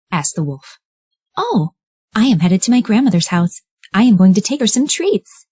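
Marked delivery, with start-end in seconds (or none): 1.4-5.5 put-on high, sweet little girl. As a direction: play the eager young girl. Red answers the wolf.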